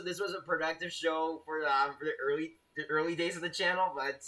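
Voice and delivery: usual speaking voice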